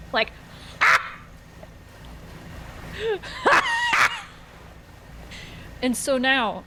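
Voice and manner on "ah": high, long burst of imitated guffawing; boisterous laughing. Imitating dad busting out at the frightened kid, boisterous laughing. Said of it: "boisterously laughing".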